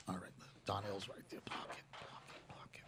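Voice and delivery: whispered, soft warning aside. Real, not acted. Warning the crew about Donnell, whispered. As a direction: hush it so only the crew hears.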